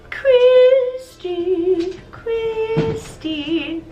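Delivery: high, eerie, drawn-out calling; ominous voice. Acted. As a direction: call her name like a distant spirit. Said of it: "this ominous voice that's just like".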